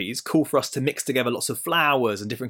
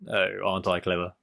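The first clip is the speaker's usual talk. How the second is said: smug; 7 semitones lower, 5 dB quieter than usual. Pretend. Smug schemer self-congratulates.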